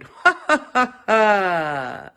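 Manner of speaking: loud crone's ha ha ha; old woman's cackle. Acted. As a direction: laugh down at the foolish antelope. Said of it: "And the old woman went".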